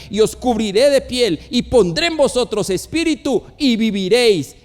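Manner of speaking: loud, commanding prophetic declamation; scripture proclamation. Acted. God ordering the prophet to speak to bones, loud proclamation. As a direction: proclaim it with ringing force.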